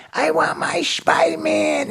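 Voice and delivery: very high, drawn-out childlike fake wail; feigned tantrum. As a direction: throw a put-on helpless fit. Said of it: "I just went into spaz mode".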